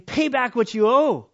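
loud, high, slow furious demand; angry demand. Acted. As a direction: bark the debt at him. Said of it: "began to choke him, saying".

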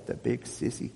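put-on whispered, small child's voice; little shaky voice. A narrator's reading. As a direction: breathe it out as a timid little boy. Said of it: "a little shaky voice going".

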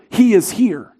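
ordinary talk

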